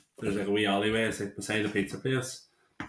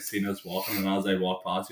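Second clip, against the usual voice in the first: normal talking voice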